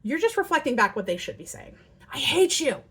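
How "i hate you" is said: put-on loud kid's snap; mock angry kid. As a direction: play the child yelling. Voicing the angry child.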